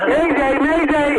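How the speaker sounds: high loud distress call; mayday shout. Pretend. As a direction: yell it like a sinking ship. Caller shouts distress call joke.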